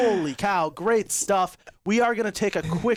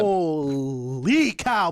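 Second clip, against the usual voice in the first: amazed; 5 semitones lower, much slower than usual. Real, not acted. Stunned after the clutch.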